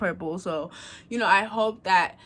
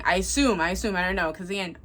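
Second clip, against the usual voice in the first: normal talking voice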